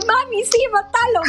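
high, mock-pleading childish voice; egging mom on from behind her back; hiding behind mom. Hiding behind her mother from the ex, high mock plea. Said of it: "Yo escondiéndome atrás de mi mamá".

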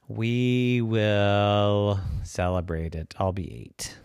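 slow and quiet, matter-of-fact; slow, soft decision. A narrator's reading. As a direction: announce the plan slowly. Girl decides calmly.

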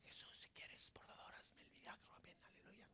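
barely audible whispered plea; hushed little prayer. Acted. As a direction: whisper it meekly, under your breath. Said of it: "no lo hizo calladitamente". (oración calladita, en susurro)

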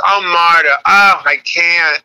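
high, drawn-out imitated mom refusal; reluctant mom. Comic retells mom dodging old friend, reluctant mom voice. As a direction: stretch it out as a mother balking.

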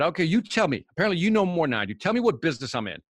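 exasperated, rushing it out; exasperated retort. Retold or imitated. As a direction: throw the question back, irritated.

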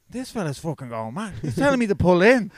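indignant imitation of a driver, rising; agitated retelling. Mate reports the tailgater to his friend, mildly agitated. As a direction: build into worked-up disbelief.

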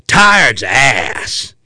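slow, drawn-out mock cop retort; imitating a cop's stretched put-down; cop's stock comeback. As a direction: draw it out as a dismissive cop. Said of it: "He goes".

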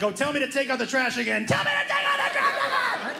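rising to a high mock yell; daring dad to start something, building to a squeal; defiant teen challenge. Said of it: "everybody looks at their dad".

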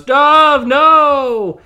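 high, loud cry of grief at a fall; mock-dramatic anguished yell; anguished shout. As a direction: scream it as your partner goes over the cliff. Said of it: "and Hank goes".

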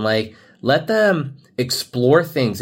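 ordinary talk, nothing marked

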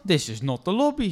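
both blurt it out in shock; surprised protest. Acted. Two women as the lift doors open, startled loud protest. Zeiden ze tegelijk.

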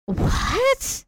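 a stunned grandmother can't believe it; incredulous. Retold or imitated. Grandmother shocked at the boy.

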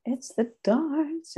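drawn out, rising at the end; slow reveal. Acted. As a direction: tease it out slowly with a lift. Teasing reveal of darts topic.

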